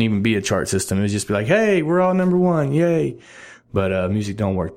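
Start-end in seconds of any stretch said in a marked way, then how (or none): 1.5-3.2 high-pitched pretend hooray; mock cheer. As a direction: cheer like everybody just won, hammed-up. Mock cheer of everyone winning.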